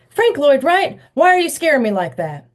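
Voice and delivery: high, loud startled exclamation; startled outburst. A narrator's reading. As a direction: jump and blurt it out in fright. Thad startled by sudden voice.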